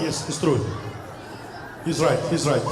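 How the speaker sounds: low, soft dreamy agreement; a touch of swooning women. Retold or imitated. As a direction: murmur it like an admirer.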